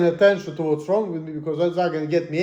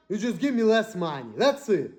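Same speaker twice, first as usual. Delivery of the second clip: ordinary talk, nothing marked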